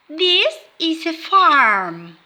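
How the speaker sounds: put-on slow, careful model word for kids; slow classroom modelling; clear teacher model. Shown as a demonstration. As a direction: say it slowly so children can copy. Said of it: "Can you repeat?".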